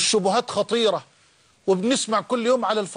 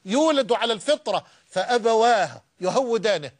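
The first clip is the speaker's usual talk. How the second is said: fiery preaching; 6 semitones higher than usual. Acted. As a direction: preach it out to the crowd at full force. Preacher rising to full force. (خطبة حماسية بصوت عال ومرتفع)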